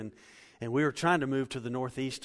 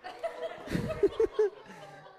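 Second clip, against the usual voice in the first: long high-pitched laugh; high real laughter. Real, not acted. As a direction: let the laugh run out high. Laughs at tarantula.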